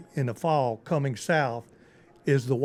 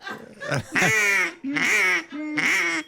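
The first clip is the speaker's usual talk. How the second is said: duck call honks. Shown as a demonstration. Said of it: "that slow, seductive call".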